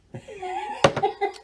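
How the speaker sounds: high giggly laugh through words. Real, not acted. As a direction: laugh the question out. High laughing end of a boast.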